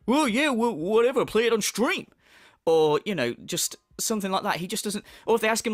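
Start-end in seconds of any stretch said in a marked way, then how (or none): 0.1-2.1 mock dismissive streamer; hammed-up brush-off; Phil impression. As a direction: imitate a streamer brushing off a question. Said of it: "And he'll go".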